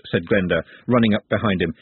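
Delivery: ordinary delivery, nothing marked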